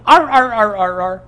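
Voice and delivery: high, loud imitated barks; yapping "arr, arr"; barking animal. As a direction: bark like a small angry dog. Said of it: "the thing is at the bottom of the tree going".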